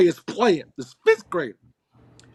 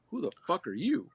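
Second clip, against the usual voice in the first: muted indignation; 13 dB quieter than usual. Retold or imitated. Dad retelling his reply to a little boy, quietly indignant. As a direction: say it under the voice, as a protective dad.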